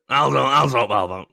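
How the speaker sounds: a hint of a shady fixer; lightly put-on tough guy; odd put-on voice. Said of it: "I don't know why I'm talking like this".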